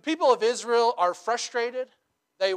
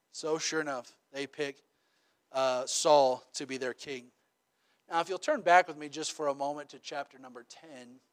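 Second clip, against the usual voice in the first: usual speaking voice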